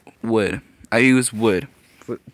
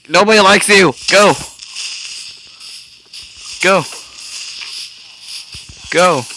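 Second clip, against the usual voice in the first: shooing shout; 6 semitones higher, 3 dB louder than usual. Real, not acted. Shooing villager away.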